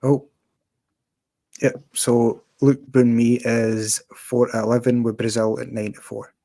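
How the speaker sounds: ordinary talk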